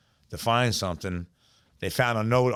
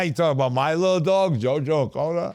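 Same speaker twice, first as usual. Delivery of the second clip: laughing; 5 semitones higher, 3 dB louder than usual. Real, not acted. Laughs at teasing of his dog.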